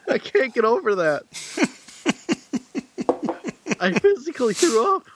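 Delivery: high, laughing disbelief. Real, not acted. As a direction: laugh through the words.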